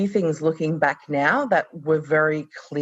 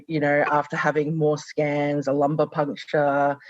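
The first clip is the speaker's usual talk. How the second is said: normal talking voice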